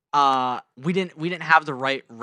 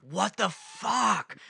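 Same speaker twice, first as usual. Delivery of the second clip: mock angry disbelief; 7 semitones higher, much slower than usual. Yelling at the driver after crash.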